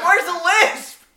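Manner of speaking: high, slow, drawn-out challenge; exaggerated exasperated question; mock indignation. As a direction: demand it in a high, put-out voice. Indignant retort to teasing.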